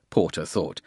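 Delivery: ordinary delivery, nothing marked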